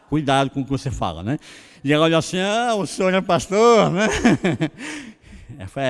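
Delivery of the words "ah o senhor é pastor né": mocking reaction. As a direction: retell a clerk's sarcastic "oh, really", laughing after. Clerk mocks his being a pastor.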